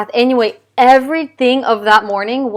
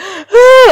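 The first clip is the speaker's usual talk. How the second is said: loud, high gasping wail; loud cry; 14 semitones higher, 12 dB louder than usual. Retold or imitated. Mom demonstrating her bawling on the bed, mock loud cry. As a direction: imitate a sudden bawling sob, sharp and high. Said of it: "like a loud cry".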